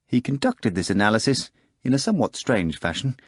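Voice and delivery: ordinary talk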